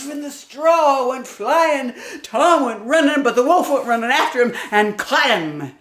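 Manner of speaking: high, loud breathless chase; high loud rushing narration; excited chase. A narrator's reading. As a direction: tell the chase at full speed.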